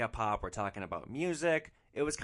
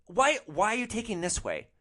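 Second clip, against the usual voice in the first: indignant passenger; 10 semitones higher, 6 dB louder than usual. Retold or imitated. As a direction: imitate a complaining rider, high and loud. Passenger challenges the driver's route.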